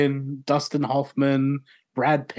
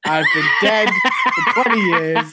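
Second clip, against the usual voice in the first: louder, higher mock apology breaking into laughter; joking dead composer; 5 semitones higher, 6 dB louder, faster than usual. Dead composer apologising for no recent work, joking mock apology. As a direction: apologise in jest as the deceased, loudly.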